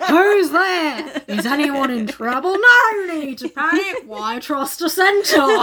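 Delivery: loud, hammed-up bad Australian accent; Bruce from Finding Nemo. Centaur horseman introducing himself as a shark, bad Australian accent. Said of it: "bad Australian accent man".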